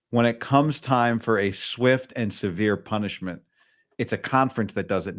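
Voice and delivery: ordinary talk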